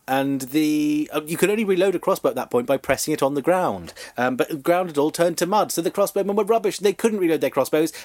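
ordinary talk

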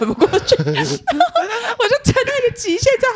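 real giggles while telling it; breaking into laughter at her own story; laughing. Real, not acted.

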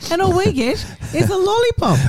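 a lollipop, pitched right up in playful outrage; mock indignant. Real, not acted. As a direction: complain high and comic about the lollipop.

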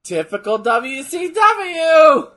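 mock shout. Real, not acted. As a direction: drag it out as a big sarcastic yell. Watching wrestling, mocking the promotion.

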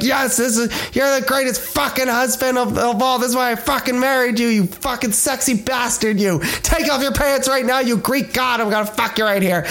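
high, gushing mock praise; gushing wife. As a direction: gush over him like a smitten wife. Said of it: "And Sarah's like".

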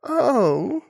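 high, drawn-out little "oh"; bunny realising. Acted. Bunny learning of his mix-up, sheepish. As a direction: let it dawn in a high voice.

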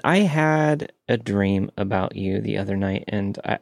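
ordinary delivery

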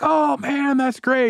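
lightly mock gushing rich folks; impressed crowd. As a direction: fawn like eager donors. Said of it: "They're like".

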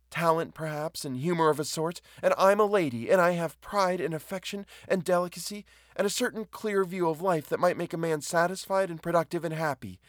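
high-pitched impassioned woman pleading her worth; hurt dignity in a high, insistent voice; wounded, proud woman. Acted.

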